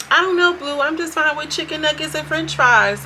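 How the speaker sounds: mocking girly voice. Mocking her voice.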